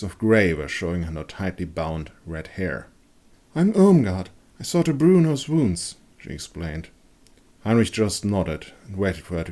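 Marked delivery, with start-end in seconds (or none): 3.6-6.0 higher, louder kindly woman; a hint of a kind village nurse; lightly voiced woman introducing herself; lightly voiced Irmgard. A narrator's reading.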